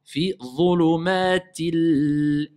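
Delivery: slow chant of dhulumatil, holding the L; measured tajwid recitation; Quran recitation. Shown as a demonstration. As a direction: sustain each long syllable of Fi dhulumatil. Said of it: "C'est pas a".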